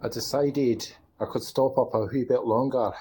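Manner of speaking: put-on broad Scottish accent, rolling the words. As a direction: say it like a Glaswegian lad. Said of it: "some Scottish accent".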